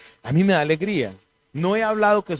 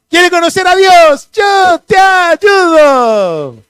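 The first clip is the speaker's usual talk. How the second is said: hammed-up shout, much higher and louder, dragged out slowly; mock evangelist shout; 11 semitones higher, 11 dB louder, much slower than usual. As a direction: bellow it like a pushy street preacher. Mocking pushy street evangelist. (grito exagerado de predicador callejero, muy agudo y lento)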